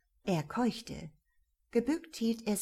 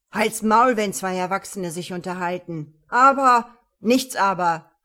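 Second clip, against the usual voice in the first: furious shout; 12 dB louder than usual. Acted. Cop shouts down suspect.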